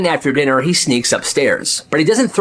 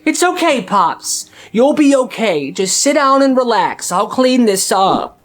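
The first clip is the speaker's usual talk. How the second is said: much higher, slow coaxing; mock sweet mom; 7 semitones higher than usual. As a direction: soothe grandpa like a child. Mom soothing grandpa.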